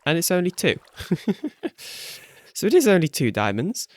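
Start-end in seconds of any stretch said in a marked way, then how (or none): 1.0-2.1 soft breathy laugh. Real, not acted. Laughs at only two diamonds.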